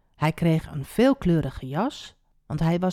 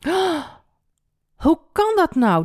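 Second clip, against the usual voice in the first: high gasped "oh", then alarmed question; startled; 10 semitones higher, 4 dB louder than usual. A narrator's reading. Ruben finds the pit empty, startled. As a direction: react with a shocked, high-pitched voice. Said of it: "Ruben schrok".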